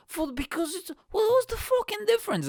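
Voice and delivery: high, loud incredulous rant; exasperated outburst. Real, not acted. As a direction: explode in disbelief. Exasperated rant about the walk.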